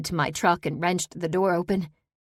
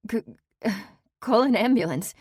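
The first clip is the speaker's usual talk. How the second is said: stumbling start, then higher and urgent; stammered urgency; 6 semitones higher, 3 dB louder than usual. Acted. Ex-convict shaken beside the body, stammering higher. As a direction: stammer out the order in shock.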